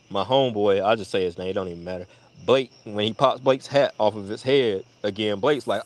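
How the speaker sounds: ordinary talk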